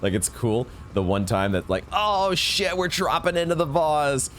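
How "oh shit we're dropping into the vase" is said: mock excitement. Mock self-quote while gaming.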